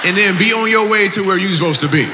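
excited. Real, not acted. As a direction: whoop it up at the crowd, laughing. Comic thrilled at audience story.

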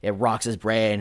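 normal talking voice

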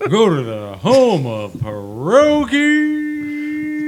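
high, loud, slow announcer bellow; booming ring announcer. Acted. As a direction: stretch it out like a big arena intro. Hammed-up ring announcer boom.

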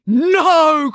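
loud, high, long-held shout; horrified cry. A narrator's reading. April fearing for her room, horrified cry. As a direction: cry out in dismay, stretching the vowel. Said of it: "cried April".

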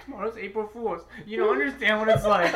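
high-pitched imitation of tearful dread; hammed-up weepy complaint; mock crying. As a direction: whimper it in comic despair. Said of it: "Jill be crying and be like".

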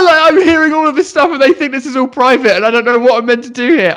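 frantic. Real, not acted. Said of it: "frantically going".

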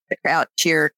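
ordinary delivery, nothing marked

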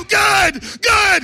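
loud, high mocking shout; shouted taunt. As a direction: bark it back at them, gloating. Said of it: "I go".

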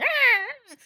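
a high, squealing horse cry; mock horse whinny. Storyteller imitating a nervous horse, high squeal.

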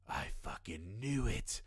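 hushed whisper; a grim, hushed realization; whispered line. Retold or imitated. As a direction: breathe it out in a whisper. Loomis line quoted.

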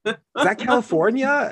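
high, innocent mock question; teasing the boss by pretending not to know; playing dumb. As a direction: feign total cluelessness. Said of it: "I was like".

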